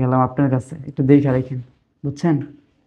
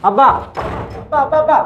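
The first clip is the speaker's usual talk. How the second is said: much higher, pleading cry; son-in-law calling desperately after his father-in-law; 10 semitones higher than usual. Acted. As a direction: call out desperately.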